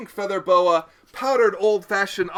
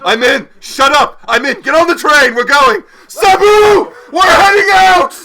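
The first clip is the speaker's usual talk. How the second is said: much higher and louder, yelling orders; excited shouting; 9 semitones higher, 16 dB louder than usual. Acted. Lord Nauticus ordering everyone onto the train, excited shouting. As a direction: shout with wild glee to get moving.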